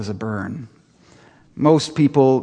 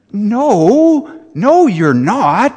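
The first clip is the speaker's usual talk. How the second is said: Buddy the elf; 8 semitones higher, 5 dB louder, much slower than usual. Retold or imitated. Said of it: "Here's Will Ferrell".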